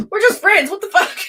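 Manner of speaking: hammed-up shocked outburst; mock shocked reaction. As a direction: act out baffled outrage, laughing through it. Mock reaction to being friend-zoned.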